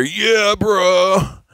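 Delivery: mock bro voice. Mocking a fighter's face in a photo, a hammed-up high bro voice. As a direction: ham up a lazy bro's "yeah, brah". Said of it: "This face right here".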